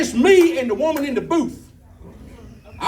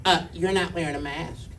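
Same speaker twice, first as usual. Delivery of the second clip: low, soft voice of the booth clerk; intercom clerk; 5 semitones lower, 7 dB quieter than usual. Retold or imitated. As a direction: lower and quieter, imitating her on the intercom. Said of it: "She jugged the intercom and said".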